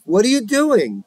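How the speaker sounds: high, loud and startled; stunned father yelling at his son; shocked question. Acted. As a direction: catch him red-handed. Bedazzled father sees cookie theft.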